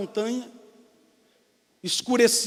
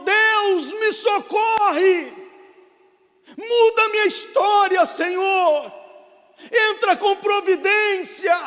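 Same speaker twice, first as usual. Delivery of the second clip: higher, louder, dragged-out shout to God; desperate cry for help; 10 semitones higher, 7 dB louder, much slower than usual. Acted. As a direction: cry out into the dark, stretching the plea. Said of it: "E ele grita de novo".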